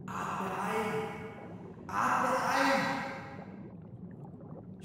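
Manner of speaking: high cry from far away; distant shout. Acted. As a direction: call out from far off. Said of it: "schrie jemand weit entfernt".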